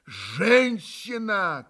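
an uncontrollable cry of pity; unrestrained outburst. A narrator's reading. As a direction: let it break out of him, slowly. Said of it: "как-то совсем безудержно вырвалось".

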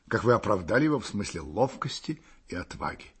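plain reading voice

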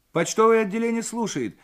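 put-on high-pitched young telephone operator; ringing girlish voice. A narrator's reading. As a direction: answer the line in a high, ringing girl's voice. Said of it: "зазвенел по ту сторону девичий голосок". (звонкий девичий голосок)

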